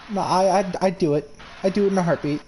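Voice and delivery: ordinary talk, nothing marked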